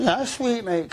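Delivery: smug, high-pitched mock guard voice; cocky. Guard answering the prisoner at the airport, cocky and smug. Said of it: "Cocky as fuck".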